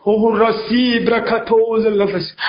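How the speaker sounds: low, slow hushed tongues; praying in tongues. Real, not acted. Pastor closes the tongues section, low and slow.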